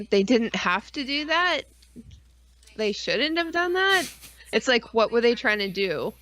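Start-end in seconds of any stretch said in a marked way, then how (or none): none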